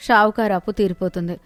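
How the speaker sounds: usual speaking voice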